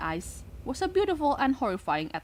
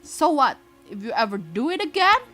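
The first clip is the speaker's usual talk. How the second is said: taunting bully; 5 semitones higher, 6 dB louder than usual. Acted.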